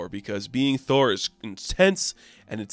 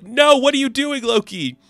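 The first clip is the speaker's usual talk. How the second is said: high, louder alarmed yell; panicked shout at Loki; alarmed cry; 11 semitones higher, 5 dB louder than usual. Acted. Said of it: "Vader Bill's like".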